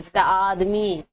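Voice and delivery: آدمی with a long stretched opening aa, said slowly; slow madd reading of آدمی; drawn-out madd. Shown as a demonstration. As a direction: stretch the first vowel of آدمی, "aaadmi". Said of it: "اسے کھینچ کر پڑھا جاتا ہے".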